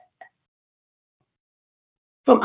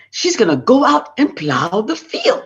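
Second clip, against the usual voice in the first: usual speaking voice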